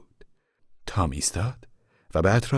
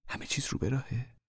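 higher anxious whisper, rising; whispered question; 6 semitones higher, 10 dB quieter than usual. Acted. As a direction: whisper the worry, ending up.

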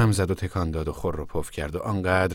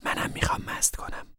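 secret whisper into an ear; little girl whispering into her brother's ear; 6 dB quieter than usual. A narrator's reading. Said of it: "در گوشی به او گفت".

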